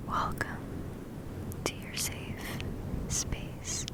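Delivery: ordinary talk, nothing marked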